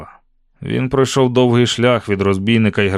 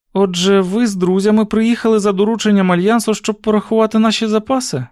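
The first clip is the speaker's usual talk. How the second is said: put-on higher-pitched girl's voice asking; young heroine, inquiring; 10 semitones higher, 3 dB louder than usual. A narrator's reading. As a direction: voice the heroine's question high and lively. Narrator voices Susan questioning Will. (високий голос допитливої дівчини)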